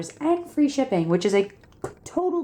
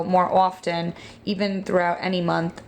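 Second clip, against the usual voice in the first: ordinary talk, nothing marked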